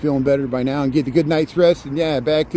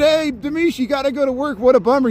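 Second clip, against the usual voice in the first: teasing sing-song greeting; 10 semitones higher than usual. Retold or imitated. Retelling own reply to streamer.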